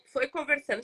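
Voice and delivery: usual speaking voice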